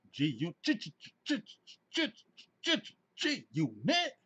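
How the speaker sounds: high stuttered hype chant; choppy, high-pitched crew shout-out. Acted. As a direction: stutter the letter G, then pitch up. Imitates G-Unit ad-lib shout.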